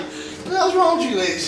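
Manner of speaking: high, laughing teasing question; laughing disbelief. Retold or imitated. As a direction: tease them through a laugh. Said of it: "I'm like".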